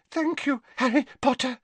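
very high, breathless elf squeak; out of breath after struggling; panting. Acted. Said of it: "he panted".

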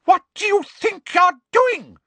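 high, loud scream of outrage; shrill-pitched furious yell. Acted. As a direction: scream it like a scandalised games teacher. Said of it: "screamed Madam Hooch".

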